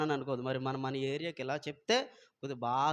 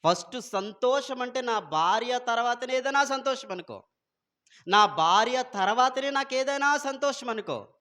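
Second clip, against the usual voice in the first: higher, louder fiery preaching; preaching at full force; 8 semitones higher, 6 dB louder than usual. Real, not acted. Preacher exhorting husbands to put their wives first, fiery and loud. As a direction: thunder the point home to the husbands. Preacher drives the point home.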